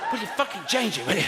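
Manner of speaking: lightly voiced fed-up choreographer; exasperated choreographer. Choreographer snaps at the dancer.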